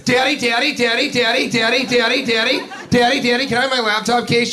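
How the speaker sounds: put-on high, far whiny little-girl voice; imitating a clingy adult daughter; whiny grown daughter. Comic mimicking a whiny daughter on a flight. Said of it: "The, uh, daughter sounded like this".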